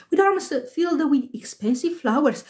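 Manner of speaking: plain reading voice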